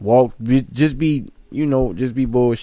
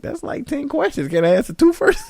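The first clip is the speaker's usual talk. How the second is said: laughing comeback. Retold or imitated. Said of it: "I'll be like".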